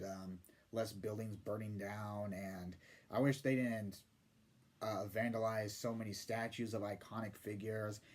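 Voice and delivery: lightly voiced timid pushover; mock Chris Stuckman. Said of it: "be like Chris Stuckman ... most pansy-ass way possible".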